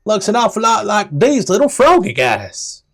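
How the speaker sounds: a chirpy guide pointing things out; high NPC voice. Acted. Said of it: "and goes".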